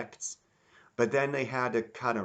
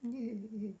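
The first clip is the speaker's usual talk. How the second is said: high, limp and quiet mock voice; lifeless, mocking impression of the redubbed voice; bored impression; 9 dB quieter than usual. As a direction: toss it off like you couldn't care less. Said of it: "his voice intonation was like".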